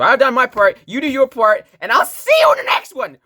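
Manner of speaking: high, loud, climbing to a yell; rousing shouted sign-off. Pretend. Teacher sending learners off to practise, excited shouting. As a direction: build excitedly to a shouted farewell.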